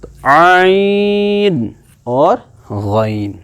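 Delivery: عین and غین stretched slowly from the throat; teacher models عین and غین for students; drawn-out letter names. Shown as a demonstration. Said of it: "dots کے اوپر خاص خیال رکھنا ہے".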